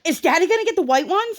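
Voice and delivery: high-pitched imitation of an eager little kid; mimicked kid's high question; excited child. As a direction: pipe up like an impatient child asking about Daddy. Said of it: "Briar was just like".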